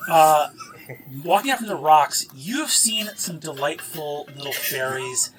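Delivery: ordinary talk, nothing marked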